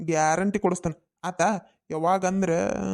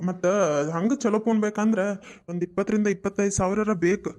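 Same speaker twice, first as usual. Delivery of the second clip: ordinary delivery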